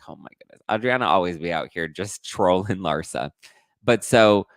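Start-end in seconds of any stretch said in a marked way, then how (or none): 0.1-0.6 whispered oh my goodness; stunned whisper. Retold or imitated. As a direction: breathe it out in shocked disbelief. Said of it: "And I was just like".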